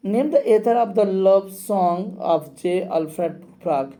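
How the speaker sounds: reads the quiz question slowly and clearly; slow dictation reading. Shown as a demonstration. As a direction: spell the question out for the class. (प्रश्न को धीरे-धीरे पढ़कर सुनाना)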